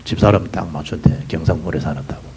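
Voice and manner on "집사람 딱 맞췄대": normal talking voice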